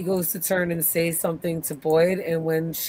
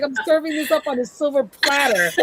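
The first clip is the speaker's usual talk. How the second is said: laughing quote; 9 semitones higher than usual. Retold or imitated. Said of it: "He's like".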